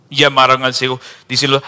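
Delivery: ordinary talk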